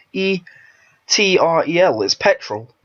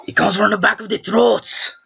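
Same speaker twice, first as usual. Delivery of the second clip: higher, throaty Spanish accent; Esteban; 5 semitones higher than usual. Shown as a demonstration. Explaining where the rival's voice comes from, in his Spanish accent. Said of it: "he talks like this".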